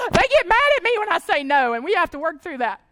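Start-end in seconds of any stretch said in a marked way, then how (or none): 0.0-1.7 high laugh into the words. Real, not acted. Mom laughing about her kids getting mad. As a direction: laugh it off as you speak.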